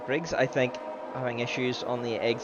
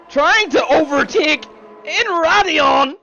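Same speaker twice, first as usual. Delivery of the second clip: excited overtake call; 9 semitones higher, 8 dB louder than usual. Acted. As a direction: build it as the lead changes. Overtake for the lead.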